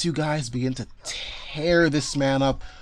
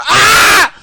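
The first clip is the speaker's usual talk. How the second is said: full piercing scream, much higher and louder; 15 dB louder than usual. Acted. Game master voicing screaming guys in the corner, piercing scream. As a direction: let out a shrill scream of pain. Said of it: "hear those guys screaming".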